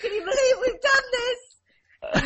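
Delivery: high, loud, shrieking with laughter; cackling with glee. Retold or imitated. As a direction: imitate a mum crying with laughter at her own prank. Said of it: "my mum literally just laughing".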